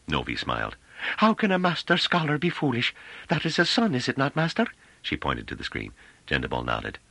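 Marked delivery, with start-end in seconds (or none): 1.2-4.7 high-pitched, deferential character voice; high, artless admiration; timorous woman. A narrator's reading. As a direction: voice a meek woman addressing her master. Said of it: "in a timorous manner".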